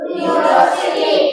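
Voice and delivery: drawn out, almost whispered; slow breathy model word. Shown as a demonstration. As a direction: breathe the word out slowly for the class. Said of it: "उसका उच्चारण यू हो रहा है". (धीमे, लगभग फुसफुसाकर बोला गया शब्द)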